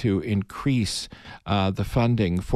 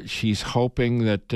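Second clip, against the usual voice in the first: normal talking voice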